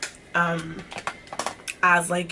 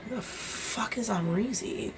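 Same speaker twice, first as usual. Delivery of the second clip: puzzled aside; 3 semitones higher, 10 dB quieter, slower than usual. Retold or imitated. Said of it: "I was like".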